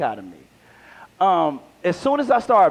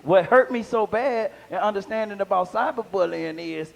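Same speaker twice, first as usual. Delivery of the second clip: ordinary delivery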